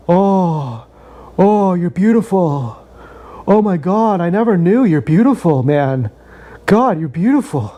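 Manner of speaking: slow, breathy-free dreamy wonder at a mirror; dazzled awe. Retold or imitated. Admiring his own reflection on morphine, slow dreamy awe. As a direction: gaze at yourself, lost in wonder.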